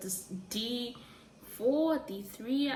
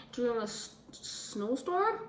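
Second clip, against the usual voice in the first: unsure question; 3 semitones higher than usual. Real, not acted. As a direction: ask "snowstorm" as if checking yourself. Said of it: "Is it?".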